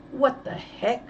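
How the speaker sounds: ordinary delivery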